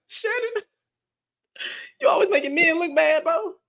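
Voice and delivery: high, laughing mock whine of a complaining viewer; mocking whiny commenter. Streamer mocks a male viewer's complaint in a high laughing whine. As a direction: whine it back at him with a laugh.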